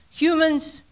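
normal talking voice

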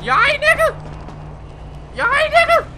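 high-pitched check. Pretend. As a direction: call out high to someone lying down. Louder repeated check on downed person.

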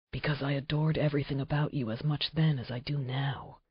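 soft, hushed confession; whispered. Acted. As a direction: breathe it quietly to her. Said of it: "he whispered".

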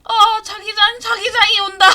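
loud high alarmed cry; alarmed shout. Real, not acted. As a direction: shout out the warning. Alarm at the zone. (다급한 외침)

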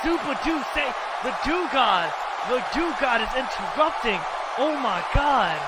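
high, excited arena announcer; high astonished hype; hyped announcer call. Acted. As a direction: sell the entrance like a ring announcer. Announcer hypes a new arrival.

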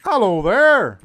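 high, loud funny holler; loud high put-on holler; loud call out. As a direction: holler across the map in a put-on voice. Calling out loud.

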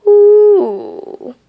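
high, long imitated hoot; owl hoot. Owl interrupts the talk.

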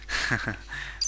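quiet airy chuckle; soft breathy laugh. Real, not acted. Laughing at his own joke about villagers, quiet chuckle.

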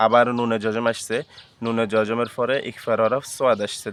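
ordinary talk

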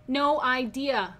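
"No idea" drawn out slowly as the punchline. Shown as a demonstration. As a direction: let "No idea" land slowly, like "no-eye deer". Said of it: "Get it?".

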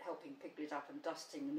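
plain reading voice

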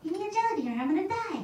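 put-on high, fast childish pleading; frantic kid. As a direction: rush it out like a panicked little kid.

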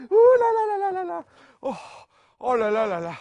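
high, loud laughing squeal; being tickled. Acted.

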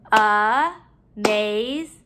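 "Amazing" slowly, in three beats; syllable by syllable. Shown as a demonstration. Teacher counting syllables in the word, broken up. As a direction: pause between each syllable of "Amazing". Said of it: "break up our syllables".

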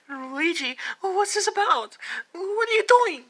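high-pitched panicked questioning; alarmed. Acted. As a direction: squeal it in rising alarm. Frightened by brother's threats.

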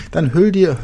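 normal talking voice

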